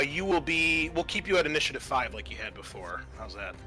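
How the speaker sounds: ordinary talk, nothing marked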